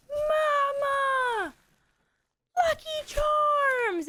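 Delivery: put-on high shrieking toddler, slow and loud. Son demanding Lucky Charms from mom, high toddler shriek. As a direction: squeal it like a demanding little boy. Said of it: "And he was like".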